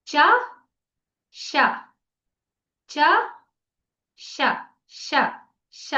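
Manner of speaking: ష said from where చ is made, tongue back; isolated ష with tongue curled up like for చ; teacher modelling the sound ష, slow and separate; retroflex sha demo. Shown as a demonstration. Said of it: "దీన్ని పలికేటప్పుడు చ ఎక్కడి నుంచి వస్తుందో అక్కడి నుంచి పలకాలి".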